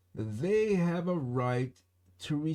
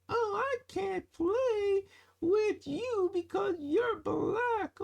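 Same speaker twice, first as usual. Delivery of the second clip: much higher, squeaky put-on kid voice; a resident voicing a kid who excludes others, pitched way up; mock child voice. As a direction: squeeze it up into a small child. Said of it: "that's a man voice, isn't it?".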